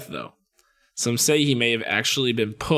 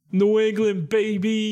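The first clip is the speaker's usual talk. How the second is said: higher hyped-up brag voice; mock hometown brag; 8 semitones higher, slower than usual. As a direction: cheer it like a proud local. Boastful aside about lobotomy origin.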